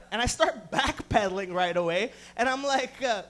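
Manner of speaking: usual speaking voice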